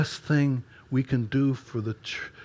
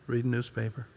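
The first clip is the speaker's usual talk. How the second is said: "Reading newspaper." mumbled flat, lifeless and much softer; bored husband answering his wife flatly; dead reply; 6 dB quieter than usual. Retold or imitated. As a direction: drop the energy out of "Reading newspaper.". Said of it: "the deadness in mine".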